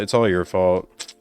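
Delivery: normal talking voice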